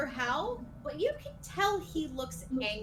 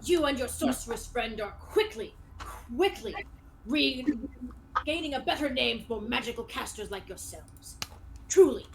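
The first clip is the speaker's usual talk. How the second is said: grand praise with bursts of volume; hearty skeleton knight; 4 dB louder than usual. Acted. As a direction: congratulate like a pompous old-world knight. Game master voices hearty skeleton knight.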